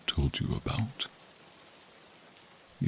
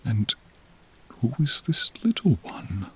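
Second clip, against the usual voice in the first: higher, hushed wonder; curious whisper; 6 semitones higher than usual. Acted. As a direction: whisper in delighted surprise. Noticing the knight's child.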